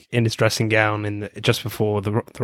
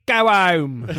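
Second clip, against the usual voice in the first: much higher, showy stage greeting; 8 semitones higher than usual. Retold or imitated. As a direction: camp it up to the crowd. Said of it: "in his [name] costume and says".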